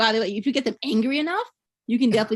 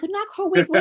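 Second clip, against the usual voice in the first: baffled question; 8 semitones higher than usual. Retold or imitated. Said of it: "And I'm like".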